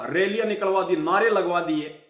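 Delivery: ordinary delivery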